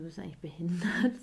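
breathy whispered insult under her breath; whispered inner scorn; whispered to herself. Retold or imitated. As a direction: mutter it privately in disbelief. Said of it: "Ich sag mir so".

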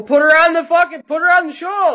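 loud, high, rowdy demand; excited shout. Real, not acted. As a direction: yell it across the room at the host. Demanding to put her on.

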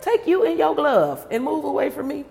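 ordinary talk

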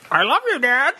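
high-pitched parrot mimicking a phrase. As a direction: squawk it like a pet parrot. Parrot repeats it, squawking.

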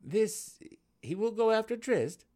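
Dinin dares to ask Malice, high; daring question. A narrator's reading. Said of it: "Dinin dared to ask".

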